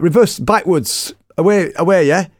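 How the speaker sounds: slow, high, loud instruction; exasperated calling out. Retold or imitated. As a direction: spell it out loudly for a confused driver. Instructing a confused driver.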